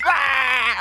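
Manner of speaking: high, loud mock scream; imitated scream. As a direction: scream it like the downed player. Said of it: "he was literally going".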